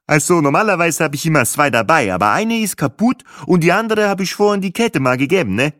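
voiced tablemate.

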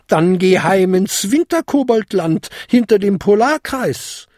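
put-on high, imperious old lady; haughty, high-pitched command. A narrator's reading. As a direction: order the goblin off, brooking no argument. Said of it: "befahl die Patentante der Feenkönigin".

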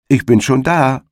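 high triumphant call across the field. Acted. Hedgehog's wife calls out triumphantly to the hare. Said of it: "sagten der Igel oder seine Frau".

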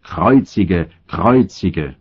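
mild narrated outcry; restrained crowd cry. A narrator's reading. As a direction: call it out as the crowd, held back. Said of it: "schrien sie und sprachen". (verhaltenes Rufen der Menge)

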